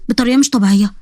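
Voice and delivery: ordinary delivery, nothing marked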